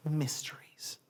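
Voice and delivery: whispered final word; soft, breath-only close to a poem; hushed. Shown as a demonstration. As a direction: let it fade into a whisper.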